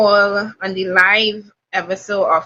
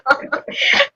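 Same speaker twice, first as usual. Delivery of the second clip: quick breathy laugh. Real, not acted. Laughs at own sarcasm.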